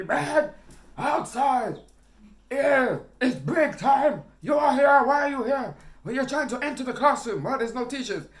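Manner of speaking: high, loud, hammed-up teacher tirade; imitated teacher shouting. Teacher ordering a pupil out at break time, shouting. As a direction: yell like an outraged teacher in mimicry. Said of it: "he's there shouting at me".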